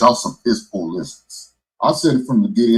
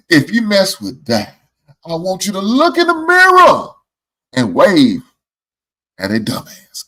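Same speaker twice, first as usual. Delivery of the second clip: high, loud, slow retold warning; booming it out like the guy who said it; mock loud lecture; 9 semitones higher, 7 dB louder, slower than usual. Friend quoting a man's warning, loud and high. Man retelling advice in loud voice.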